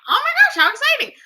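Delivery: high, loud acted delight; excited. Replaying her reaction to the sequel news, loudly excited.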